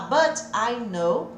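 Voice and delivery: slow "but I know", T as a flap "bãr ai"; slow flapped but I. Shown as a demonstration. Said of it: "eu vou replicando". ("but I know" devagar, T como R)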